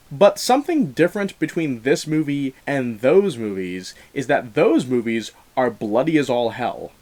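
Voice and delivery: ordinary talk, nothing marked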